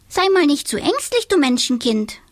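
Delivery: very high, fond teasing witch; fond little witch. A narrator's reading. Donatella pinching Tobias's cheek, fond and teasing. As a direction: tease him kindly. Witch comforting boy fondly.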